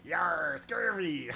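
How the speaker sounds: hammed-up pirate cry; pirate talk. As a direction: growl it out like a cartoon pirate. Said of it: "talking like a pirate".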